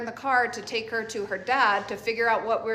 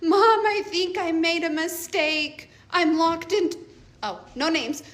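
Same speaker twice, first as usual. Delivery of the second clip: higher, pleading daughter on the phone, lightly done; imitating daughter bawling; 6 semitones higher, 4 dB louder than usual. Mother retelling her daughter's call, mild mock crying. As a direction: mimic a panicked teen calling mom. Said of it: "She's bawling".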